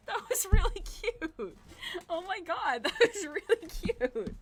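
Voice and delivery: high, slow gushing; stretched-out delighted gush; touched squeal. Real, not acted. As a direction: melt over the kind gesture. Touched by friend's gift.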